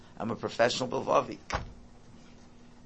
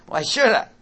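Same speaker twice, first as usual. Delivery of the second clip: put-on defiant child; 13 semitones higher, 13 dB louder than usual. Defiant kid voiced again.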